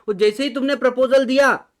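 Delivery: excited teacher emphasis; emphatic. Shown as a demonstration. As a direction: punch out proposal so the class wakes up. Teacher stresses the key moment.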